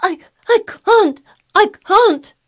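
very high sobbing wail; gasping. A narrator's reading. Hysterical boy answering Mary, gasping and crying. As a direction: wail it helplessly, catching for breath. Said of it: "he gasped".

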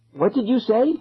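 high, insistent question; demanding. A narrator's reading. Lost kid to chanting boy, demanding. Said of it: "I demanded".

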